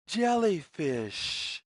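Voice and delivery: plain reading voice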